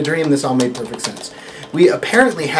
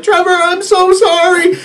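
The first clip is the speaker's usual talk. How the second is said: high, pleading, stretched-out panic; panicked apology; 7 dB louder than usual. Retold or imitated. To his deck manager, caught late backstage: panicked, pleading apology. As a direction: beg forgiveness in a frightened rush of nerves. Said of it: "And I'm freaking out".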